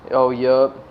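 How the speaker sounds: ordinary talk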